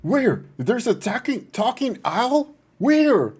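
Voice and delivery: high, loud panicky owl voice; frantic search for the talking owl; alarmed owl. Acted. Owl Alfonso panicking about a talking owl, high and loud.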